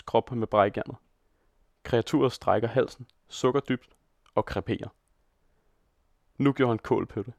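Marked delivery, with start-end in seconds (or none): none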